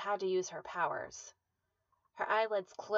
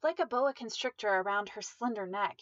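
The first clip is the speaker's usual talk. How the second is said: ordinary delivery, nothing marked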